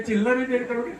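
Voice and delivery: dismissive leader. Retold or imitated. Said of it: "बड़े style से".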